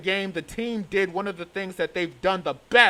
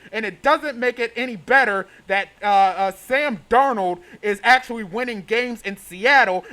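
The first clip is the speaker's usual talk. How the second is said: ordinary talk, nothing marked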